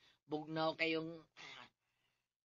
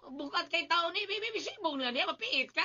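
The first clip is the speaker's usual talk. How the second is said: higher, urgent warning while straining; strained warning; 11 semitones higher, 3 dB louder than usual. Acted. Warning the baby while carrying heavy tools, strained. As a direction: blurt it out fast under a heavy load.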